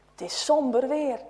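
usual speaking voice

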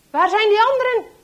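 loud, high-pitched yelled demand; shouting. A narrator's reading. Traitor demanding the others from wounded Henk, shouting. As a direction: shout it hard at a captive. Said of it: "schreeuwt de verrader".

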